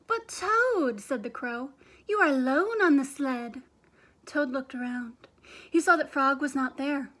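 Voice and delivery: big, loud storybook reading with acted voices; lively read-aloud. A narrator's reading. Teacher reading Frog and Toad aloud to her class, animated. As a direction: read it like a show for the kids.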